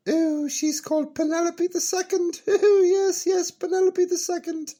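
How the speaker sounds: put-on high, giggling old character; high character voice laughing. Peachy proudly tells the host her daughter's name, laughing. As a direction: chuckle through the name, high up.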